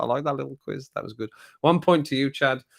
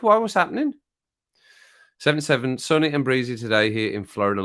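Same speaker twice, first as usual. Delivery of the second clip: normal talking voice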